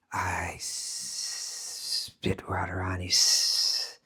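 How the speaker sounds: low, slow hissing snake. Acted. Snake confesses to the snake doctor, hissing snake voice. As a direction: draw out the s into long hisses. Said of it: "speak in the voice of the snake".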